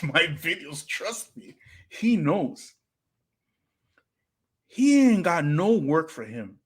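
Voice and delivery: normal talking voice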